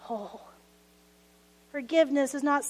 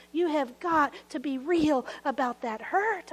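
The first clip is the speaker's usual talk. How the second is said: ordinary talk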